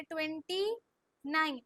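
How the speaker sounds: Twenty rising, pause, falling nine; slow dictation. Shown as a demonstration. Teacher models the number before pupils repeat, slow and split. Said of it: "slow slow बोलो पहले".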